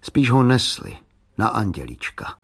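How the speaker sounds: ordinary delivery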